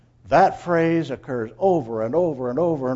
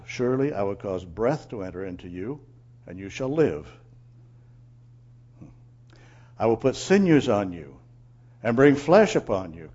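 low, measured reading of God's words; solemn scripture reading; 4 semitones lower than usual. Acted. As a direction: read it gravely, slowing on the last line. Reads God's sermon from scripture.

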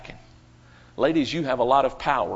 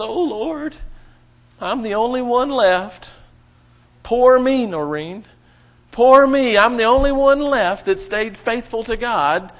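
high, loud self-pitying whine; hammed-up Elijah whining in the cave; mock whining; 7 semitones higher, 6 dB louder than usual. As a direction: pretend to whimper like a sorry prophet. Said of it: "starts crying there in the cave".

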